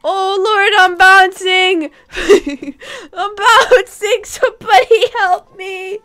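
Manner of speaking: half-joking helpless shout; giggly mock alarm; laughing panic. Real, not acted. Pet bouncing out of control.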